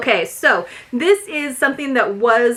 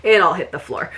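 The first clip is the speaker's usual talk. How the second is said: ordinary talk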